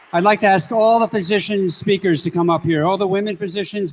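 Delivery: loud announcement over the crowd; yelling across the room. Real, not acted. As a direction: call everyone up, loud. Summoning physicians over crowd noise.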